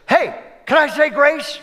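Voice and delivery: lightly voiced eager child; eager kid. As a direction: play the six-year-old eagerly asking to pray. Six-year-old kid voiced.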